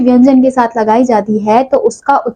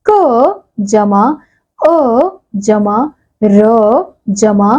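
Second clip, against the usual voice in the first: slow spelling out; much slower than usual. Shown as a demonstration. Teacher spelling out बकरी for the class, slowly. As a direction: build it up piece by piece. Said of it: "ऐसे ही अगला शब्द है".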